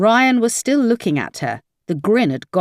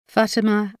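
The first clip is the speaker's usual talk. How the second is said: usual speaking voice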